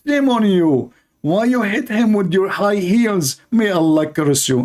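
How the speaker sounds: lightly voiced jealous heavenly wife telling off the wife; mock huri shouting. Huri watching on camera, mildly mock-shouting at the wife. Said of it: "she will be shouting from there".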